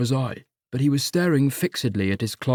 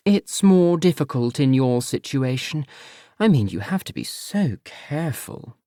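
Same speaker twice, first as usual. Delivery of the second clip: disapproving mother; 3 semitones higher than usual. Acted.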